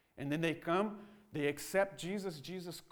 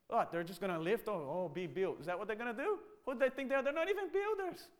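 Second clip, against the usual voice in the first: mocking Sanballat sneering at the builders; sarcastic mockery; 4 semitones higher than usual. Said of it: "ask sarcastic question".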